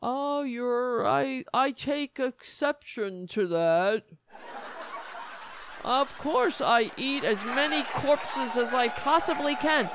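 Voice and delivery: loud, high mocking caricature; Ted Cruz. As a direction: build from mock offence into raucous gluttony. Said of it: "And then Ted Cruz is like".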